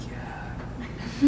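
tiny whispered retold reply; meek kid. Kid answering dad after scolding, meek imitated whisper. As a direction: imitate a chastened boy barely answering.

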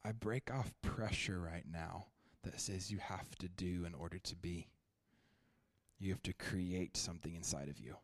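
hushed worship-leader prayer; prayer ministry. Acted. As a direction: pray gently, inviting them to receive. Prays over the congregation.

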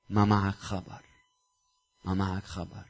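soft, low, almost under the breath; hushed rebuke. Acted. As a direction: drop the voice to a hush to make them listen. Preacher's hushed rebuke to congregation. (توبيخ هامس ومنخفض)

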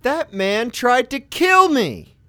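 high, loud, slow accusing outrage; seething outrage. Retold or imitated. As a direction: denounce the pilot to the whole plane. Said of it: "I'm seething".

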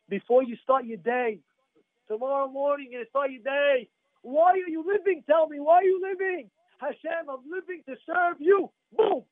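rising to high, insistent exhortation; impassioned preaching. Acted. Rabbi urging listeners before they start the day, impassioned preaching. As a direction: challenge the congregation, pitch climbing.

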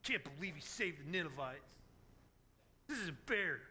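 low, sulky grumble, acted out; mock pout, muttered low. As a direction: sulk like a child after a tantrum.

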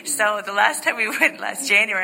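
normal talking voice

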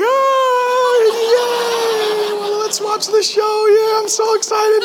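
high, hammed-up fake enthusiasm; fake excitement. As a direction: oversell the joy to hide the secret. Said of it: "I was like".

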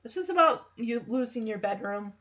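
quiet imitated uncle-to-nephew concern; heart-to-heart. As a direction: ease into a sincere talk. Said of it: "has a heart-to-heart with Jackson".

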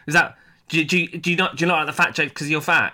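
ordinary talk, nothing marked

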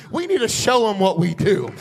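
loud, high, shouted preaching over music; a revival preacher at his peak, shouting praise; full-force preaching. Acted. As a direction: preach it to the back row at full cry.

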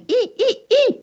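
very high, squeaky mouse sounds; little boy answering his mum in mouse squeaks. A narrator's reading. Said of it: "replied David".

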